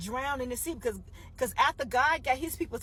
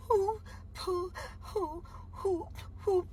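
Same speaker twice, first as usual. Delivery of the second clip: imitating Pharaoh's high wailing sobs; a high, hooting mock cry; 6 semitones higher, 4 dB quieter, slower than usual. Pharaoh crying for his dead son, high wails.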